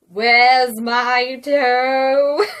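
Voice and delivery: loud, very slow eerie moan; weird groaning voice. A narrator's reading. Groaning voice calls again, closer now, loud drawn-out groan. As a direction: wail it out loud, dragging every word. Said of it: "It groaned".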